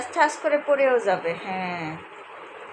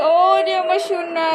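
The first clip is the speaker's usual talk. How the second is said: slow, higher tender comforting; tender soothing; 6 semitones higher, 6 dB louder, slower than usual. Real, not acted. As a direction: melt into soothing your little one. Comforting the crying child.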